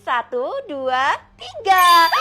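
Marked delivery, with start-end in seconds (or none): 1.4-2.1 a sudden loud, higher "tiga" to scare; scary reveal shout. Acted. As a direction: bark the last count to make her jump. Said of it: "Saya tuh cuman acting".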